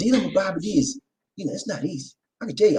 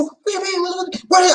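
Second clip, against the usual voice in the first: high-pitched, flustered stammer; exaggerated squeaky protest; acting out bewildered self; 13 semitones higher than usual. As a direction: retell it with comic bafflement. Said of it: "I said".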